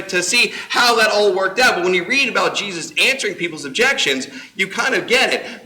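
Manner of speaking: normal talking voice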